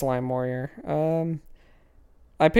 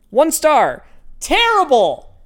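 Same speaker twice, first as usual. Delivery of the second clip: mock outraged reviewer; 12 semitones higher, 6 dB louder, slower than usual. Reading a scathing review aloud.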